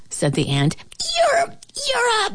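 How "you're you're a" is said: very high, choked sputter; straining flea. A narrator's reading. Flea bursting to insult ant, high choked sputter. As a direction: squeak it out as if about to burst.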